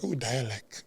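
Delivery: a hint of a playful cousin's tease; Sharissa's voice. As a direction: cousin teasing from beyond, lightly voiced and soft. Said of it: "Sharissa was like".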